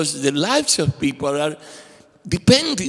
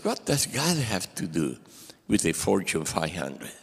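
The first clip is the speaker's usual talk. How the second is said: much lower, softer incredulous boss voice; skeptical boss; 9 semitones lower, 8 dB quieter than usual. Retold or imitated. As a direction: scoff in disbelief, low and quiet.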